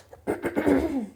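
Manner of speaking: light throat clearing. Real, not acted. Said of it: "because I can't speak".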